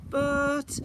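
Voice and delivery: high, loud cut-off protest; interrupted protest. A narrator's reading. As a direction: start to object then stop. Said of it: "I began".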